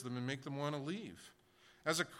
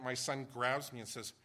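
ordinary talk, nothing marked

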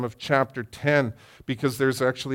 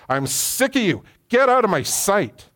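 Pharaoh snapping at Moses to leave; angry Pharaoh; 6 semitones higher than usual. Retold or imitated. Pharaoh voiced in altercation.

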